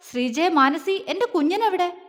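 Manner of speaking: high-pitched demanding question; angry roar, contained. A narrator's reading. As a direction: call the names, then demand angrily. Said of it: "ദേഷ്യം നിറഞ്ഞ അലർച്ചയ്ക്കൊപ്പം ആ ചോദ്യവും".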